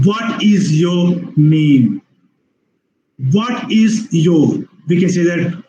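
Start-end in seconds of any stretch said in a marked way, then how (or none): 0.1-2.0 "What is your name" very slow, word by word; slow, deliberate "What is your name"; full uncontracted form. Shown as a demonstration. 3.3-4.6 "What is your" slow, three separate words; slow, deliberate "What is your"; full uncontracted form. Shown as a demonstration. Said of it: "how what is your becomes".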